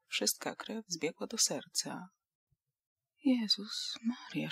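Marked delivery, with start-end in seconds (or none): 3.2-4.5 breathless frightened whisper. A narrator's reading. Łucja whispers to herself on hearing of the rabid dog, slow whisper. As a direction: whisper it in sudden dread. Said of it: "szepnęła".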